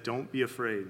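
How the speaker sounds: hushed, tender reassurance; comforting voice. Retold or imitated. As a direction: say it gently, dropping low to comfort the frightened. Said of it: "in a comforting voice said".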